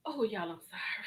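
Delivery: breathy whispered apology to viewers. Real, not acted. Reactor whispers an apology to viewers after ranting. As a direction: whisper a sheepish sorry.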